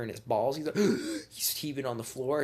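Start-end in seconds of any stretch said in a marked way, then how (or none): 0.8-1.2 loud, high wheezing intake; pained gasp. Acted. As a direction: gasp as if kicked in the groin. Retold kick in the balls.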